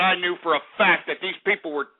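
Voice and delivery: ordinary delivery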